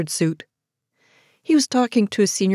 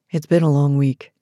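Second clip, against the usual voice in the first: lower, restrained man's line; tight male voice; 5 semitones lower than usual. A narrator's reading. Man alone with her in the elevator, voice tight. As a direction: hold it back, low and tight. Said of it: "his voice tight".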